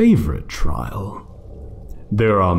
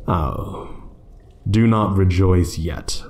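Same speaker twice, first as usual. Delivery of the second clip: ordinary talk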